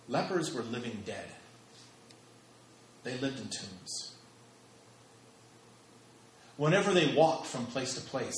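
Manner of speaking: ordinary delivery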